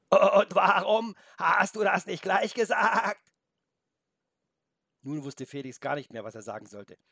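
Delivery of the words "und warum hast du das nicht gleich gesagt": high, loud, slow goat bleat. A narrator's reading. Grazing goat reproaches Felix, bleating. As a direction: bleat it with wobbling, stretched vowels. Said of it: "murmelte zwischen ihren kauenden Zähnen hindurch".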